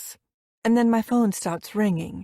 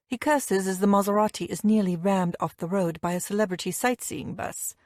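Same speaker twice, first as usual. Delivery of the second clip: ordinary delivery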